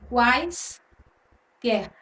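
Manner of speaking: QUAISQUER slowly parted as "quais... quer"; split into syllables. Shown as a demonstration. Teacher showing how to separate quaisquer, split into syllables. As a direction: say QUAISQUER in two parts, long pause after "quais". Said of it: "Você vai separar aí".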